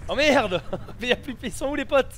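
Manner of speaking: startled laughing outburst; loud, laughing shock; surprised. Real, not acted. Game character suddenly vanishes.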